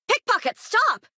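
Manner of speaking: high shout after a pickpocket; shouting at thief. Acted. Townsperson yells at a fleeing pickpocket, high alarmed shout. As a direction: yell at the thief to stop.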